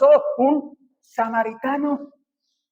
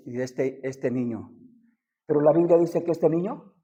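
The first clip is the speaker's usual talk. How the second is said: hushed storytelling; 8 semitones lower, 6 dB quieter than usual. Acted. Preacher telling David's grief to congregation, hushed and grave. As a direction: tell the child's death in a hush. Tells the child's death softly.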